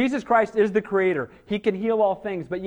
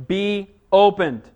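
"Be opened" slow and louder, each word weighted; emphatic command; 6 dB louder, slower than usual. Acted. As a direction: pronounce "Be opened" as a deliberate, forceful command. Reading Jesus's healing command.

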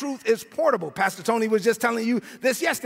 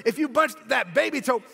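usual speaking voice

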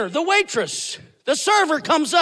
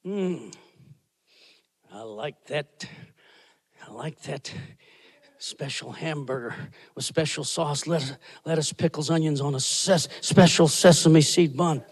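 dreamy craving; 10 semitones lower, 7 dB quieter than usual. Retold or imitated. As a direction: savor each menu item under your breath. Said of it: "you look at the image and you go".

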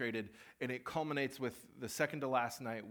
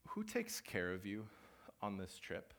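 soft inner voice; 4 semitones lower, 5 dB quieter than usual. Retold or imitated. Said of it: "he whispered just a question into my mind".

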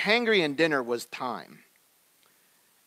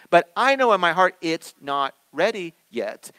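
preaching at full force; 6 semitones higher, 8 dB louder than usual. Acted. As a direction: drive the point home from the pulpit.

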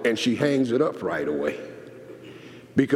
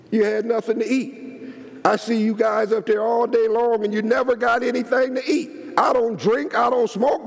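mock scolding; 11 semitones higher than usual. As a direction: playfully call them out. Pastor confronts the men, playfully.

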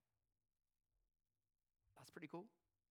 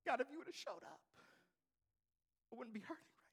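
hurt prayer; 7 dB quieter, much faster than usual. Acted. Imagined prayer of a hurting person.

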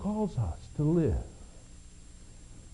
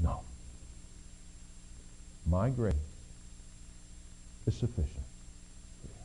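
much lower and softer, steady; calm divine answer; 10 semitones lower, 13 dB quieter, faster than usual. Acted. God answers Paul, low and calm. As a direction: answer gently with quiet authority. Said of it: "He said".

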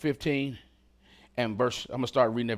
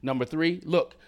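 usual speaking voice

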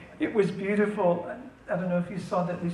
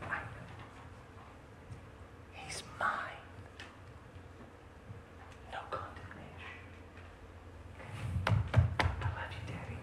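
softer unvoiced whisper, intimate; tender whisper; 15 dB quieter than usual. Jesus whispering to the Father on Chris's behalf, softly. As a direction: breathe it gently like a secret plea.

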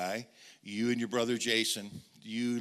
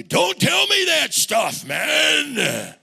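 much higher, louder drawn-out yell; furious shout; 13 dB louder, much slower than usual. Retold or imitated. Greg rejecting the preacher's God, slow furious shout.

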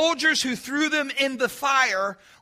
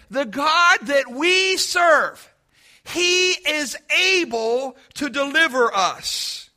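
slow declaration; 2 semitones higher, 3 dB louder, slower than usual. Acted. Preacher declaring the Hebrews' answer.

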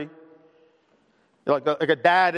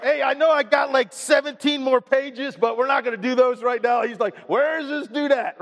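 higher, lively teasing aside; playful mock banter; 8 semitones higher than usual. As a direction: rib the tech crew for fun. Joking about slide guy.

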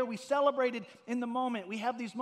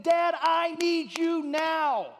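higher, louder, drawn-out shout of Dad; cry for help; 7 semitones higher, 8 dB louder, much slower than usual. Retold or imitated. Pastor recalling his trapped daughter's night-time cry, slow loud shout. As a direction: yell for your dad in the night. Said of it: "there was that cry for help".

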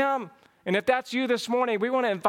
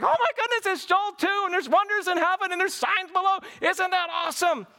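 amazed excitement; 9 semitones higher than usual. Retold or imitated. Preacher acting out a fired-up believer reading Joel, thrilled. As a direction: gush like a believer who just saw it. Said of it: "If we can't wake up and be like".

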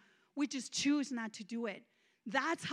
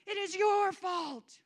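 slow raised accusing voice; angry at God; 6 semitones higher, 4 dB louder, slower than usual. Retold or imitated. Blaming God in the shower, slow and accusing. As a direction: hurl the blame upward. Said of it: "going through one of those temper tantrums".

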